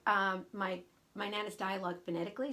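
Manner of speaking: ordinary delivery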